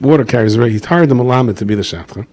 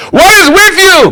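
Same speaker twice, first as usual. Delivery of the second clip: shrieked much higher; outraged yell; 8 dB louder, slower than usual. Retold or imitated. Rav screams at the matchmaker's offer, shrill and high. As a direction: shriek it in disbelief. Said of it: "how many times the Gevir yells at".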